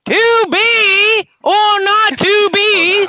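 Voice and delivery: high, slow all-caps bellow; shouted parody. Retold or imitated. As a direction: blast every word out long and high. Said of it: "you have to get it right".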